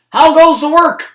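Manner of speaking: bossy farmer shouting from bed; lazy farmer calling. A narrator's reading. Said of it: "called the farmer".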